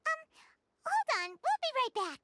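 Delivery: a flustered excuse, loud and high; flustered hurry. Acted. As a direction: blurt it out and hurry off.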